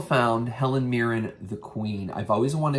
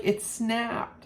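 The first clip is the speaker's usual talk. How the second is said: imitating mom; 13 semitones higher than usual. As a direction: say it small in mom's high voice. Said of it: "She's like".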